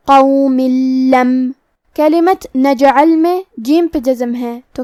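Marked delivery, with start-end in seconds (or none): 0.1-1.5 قوم لم merged without nasal, doubled ل; idgham demo. Shown as a demonstration. Teacher modelling idgham in قوم لم, clear and deliberate. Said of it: "یہ حکم کو ادا کریں گے".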